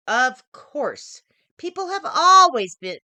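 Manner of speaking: mother explaining. A narrator's reading. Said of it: "my mother said".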